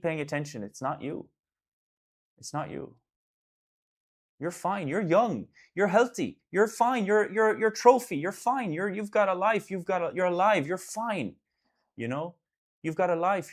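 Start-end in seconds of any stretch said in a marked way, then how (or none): 2.4-3.0 It's not you, whispered softly. Real, not acted. To a struggling friend, a soft reassuring whisper. 4.4-13.5 urgent reassurance. Real, not acted.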